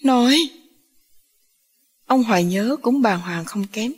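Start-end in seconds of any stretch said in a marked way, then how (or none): 0.0-0.4 higher-pitched, startled call; a touch of alarmed shock; startled cry. A narrator's reading. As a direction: call out in mild dismay. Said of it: "Hiền thảng thốt".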